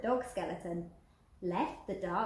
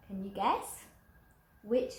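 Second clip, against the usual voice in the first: plain reading voice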